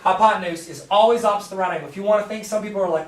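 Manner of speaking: normal talking voice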